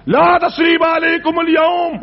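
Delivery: high, slow ringing declaration; proclaiming forgiveness. Acted. Yusuf declaring forgiveness to his brothers, slow ringing proclamation. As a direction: proclaim it out to the hall.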